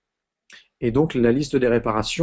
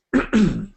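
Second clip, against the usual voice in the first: throat clearing; 3 semitones higher, 3 dB louder than usual. Real, not acted. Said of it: "Excusez-moi".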